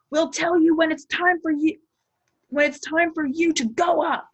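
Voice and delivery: steward's high, loud yell at the crowd; shout. Acted. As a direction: bark the crowd back from the gate. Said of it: "the steward shouted".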